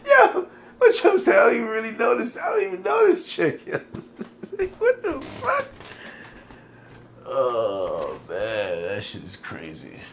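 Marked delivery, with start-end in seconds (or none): 0.1-3.6 high squealing laugh-cry through the words; laughing shout. Real, not acted. Laughing in disbelief at the woman's story, high laughing shout. 4.6-5.7 squeaky high words through laughter; laughing disbelief. Real, not acted. Cracking up at the news story, high laughing disbelief.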